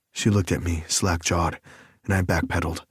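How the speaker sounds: ordinary talk, nothing marked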